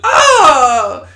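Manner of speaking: high, loud imitated anime scream, laughing through it; laughing mock shriek. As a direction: belt out a silly shriek while cracking up. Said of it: "Haru was like".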